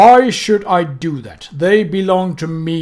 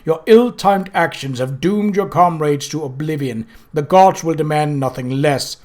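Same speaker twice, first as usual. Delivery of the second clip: louder, building angry rebuke; Death's cold fury; 2 semitones higher, 5 dB louder than usual. Acted. Death berates Mort, louder, cold and furious. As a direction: scold the apprentice with contained rage.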